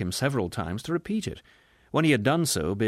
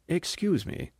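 higher, refined gentleman's politeness; cultured voice; 7 semitones higher than usual. A narrator's reading. Fat passenger leaning back to interrupt, cultured voice. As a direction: politely cut in with cultivated tones. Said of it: "in a cultured voice".

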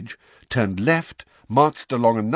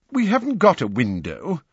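high-pitched, apologetic host; sheepish apology, pitched well up; 8 semitones higher, 4 dB louder than usual. A narrator's reading. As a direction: apologise in a raised, acted voice. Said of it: "said Mister Weasley apologetically".

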